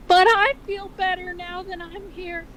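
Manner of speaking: hammed-up cartoon mom reassuring her son; mother's voice. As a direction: read Ma a pitch up with a motherly lilt. Reads Ma's dialogue.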